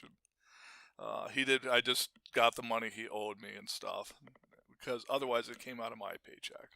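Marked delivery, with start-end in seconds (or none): none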